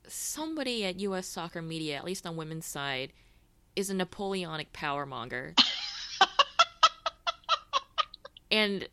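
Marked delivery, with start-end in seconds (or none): 5.6-8.5 loud high laugh. Real, not acted. Big laugh.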